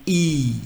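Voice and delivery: German letter I said as a long "E"; pronuncia tedesca. Shown as a demonstration. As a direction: hold "E" like the start of easy. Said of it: "I is pronunciation as".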